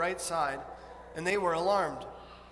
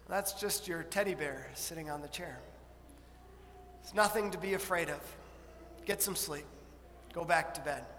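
softer, lower calming dad; soothing father; 3 semitones lower, 8 dB quieter than usual. Pretend. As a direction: gently settle a scared son. Dad calming scared son.

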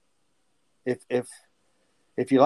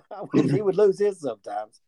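higher, quicker quoting his wife; wife's voice; 7 semitones higher than usual. Retold or imitated. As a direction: toss off the wife's puzzled question. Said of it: "my wife would be like".

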